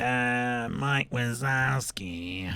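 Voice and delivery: slow, drawn-out cartoon clerk voice; Roz. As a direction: drag the name out in a smoker's drone. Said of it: "It kinda sounds like Roz from Monsters".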